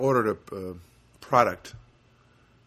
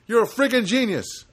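loud, high mock amazement; sarcastic praise; 12 semitones higher, 8 dB louder than usual. Caller to phone agent, loudly sarcastic praise. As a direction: burst out in sarcastic admiration.

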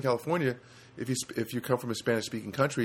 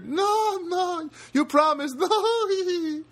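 very high, slow, mock-crying plea; mock whine; 3 dB louder, much slower than usual. Begging Rosie for the photo, mock crying. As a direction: whimper like a child denied.

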